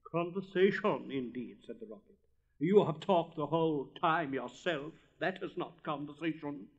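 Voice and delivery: lofty, self-important pronouncement; haughty rocket. Acted. Rocket whirling through the air, haughtily insisting.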